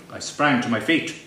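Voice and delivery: usual speaking voice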